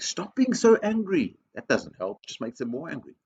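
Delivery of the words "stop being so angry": high-pitched, drawn-out scolding; exasperated order in a high voice; cross telling-off. Acted. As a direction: scold slowly, voice pitched right up. Acting the unhelpful telling-off.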